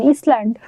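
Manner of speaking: ইসল্যান্ড with the s sounded, is-land; childhood mispronunciation. Retold or imitated. Teacher recalls how she said island as a child. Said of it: "আইল্যান্ডে আমি কি বলতাম জানেন".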